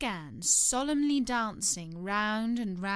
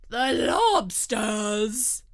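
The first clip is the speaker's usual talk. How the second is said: loud, very drawn-out cry; stretched-out storybook shout; 2 semitones higher, 7 dB louder, much slower than usual. A narrator's reading. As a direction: shout it slowly as the Gryphon. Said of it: "shouted the Gryphon".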